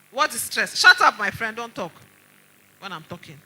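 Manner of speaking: loud, sharp shut up my friend; angry scold. Retold or imitated. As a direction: cut her off hard. Heated scolding of the resident.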